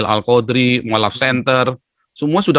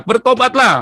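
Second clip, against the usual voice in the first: high-pitched re-enacted street-preacher cry; teriakan berkhotbah; 12 semitones higher, 3 dB louder than usual. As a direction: call out as if to a crowded terminal. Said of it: "Di jalan saya teriak-teriak". (meneriakkan seruan tobat, menirukan diri sendiri)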